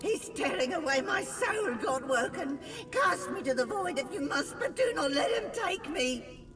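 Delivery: high, fast desperate plea; terrified pleading. Acted. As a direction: beg in panic as your soul is torn. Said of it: "Windigo's scream splits the air".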